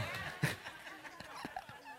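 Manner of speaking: airy high-pitched laugh; high breathless laugh. Real, not acted. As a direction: wheeze out a silent laugh. Laughing at his own story.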